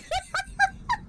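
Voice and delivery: very high rising laugh. Real, not acted. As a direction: squeal up into a laugh. Laughs at wet remark.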